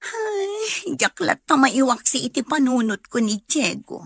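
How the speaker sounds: high, wailing sigh of longing; tearful longing. Acted. Woman pining for Diego to her friend, tearful. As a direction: ache for the man she misses.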